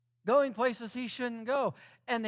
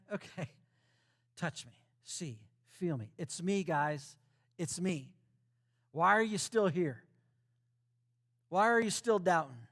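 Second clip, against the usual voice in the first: gentle Jesus voice; 3 semitones lower than usual. Acted. Jesus gently reassuring disciples.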